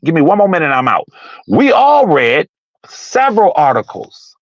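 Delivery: usual speaking voice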